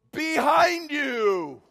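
high, loud, slow shout; family shout. Retold or imitated. Preacher retelling how his family yelled at the monster, high slow shout. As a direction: bellow it out like a whole family at once. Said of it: "the whole Baird clan goes".

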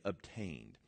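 Obtained dropped very soft and low, falling; almost whispered word. Shown as a demonstration. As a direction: breathe Obtained softly. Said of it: "That word is a great word".

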